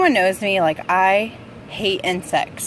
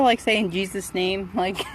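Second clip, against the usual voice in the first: normal talking voice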